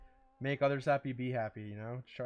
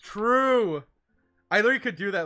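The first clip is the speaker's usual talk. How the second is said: loud, high excited agreement; 7 semitones higher, 9 dB louder than usual. Real, not acted. Agreeing to a friend's joke, loud and high excited. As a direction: jump on the idea.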